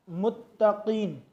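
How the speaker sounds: मुत्तकीन with the त doubled and slow. Shown as a demonstration. Teacher showing the doubled ta. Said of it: "दो मरतबा पढ़ा जाएगा".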